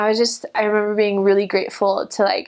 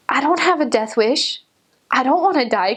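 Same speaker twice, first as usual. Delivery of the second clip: mock panic; 4 semitones higher than usual. Missionary refusing the Spirit's nudge in mock panic. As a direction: protest like your life depends on it. Fearing the men on the street.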